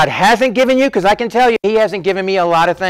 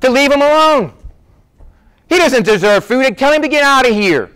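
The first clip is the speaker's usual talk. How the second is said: retold screaming; 7 semitones higher than usual. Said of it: "The person screaming at me".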